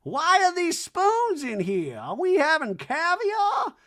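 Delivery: high, slow hammed-up Reba; lofty slow mock-mom rant. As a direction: drone it like a grand old dame. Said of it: "And she's like".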